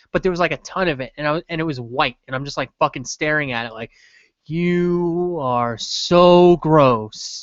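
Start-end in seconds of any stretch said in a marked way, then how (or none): 4.5-7.4 very slow, stretched-out mock revulsion; drawn-out disgust. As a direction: drag every word out in disgust. Retold inner disgust, staring at customer.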